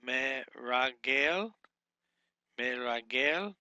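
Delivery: Meragel said slowly as me-ra-gel with a rising end; slow pronunciation demo. Shown as a demonstration. Teacher introducing the word to students, slow demo. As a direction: model Meragel slowly for the class. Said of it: "is how you would pronunciate that".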